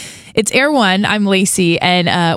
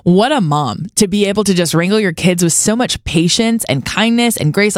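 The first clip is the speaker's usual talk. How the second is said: usual speaking voice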